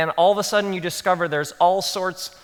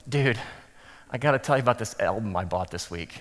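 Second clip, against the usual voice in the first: lower, fast, offhand dude voice; eager guy; 8 semitones lower, 7 dB quieter, faster than usual. Retold or imitated. A guy rushes the pastor after the sermon, lower and fast. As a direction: rattle it off like a guy who missed the whole point. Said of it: "and he says".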